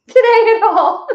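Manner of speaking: high bursting laugh. Real, not acted. Coach laughing about her husband, high and loud.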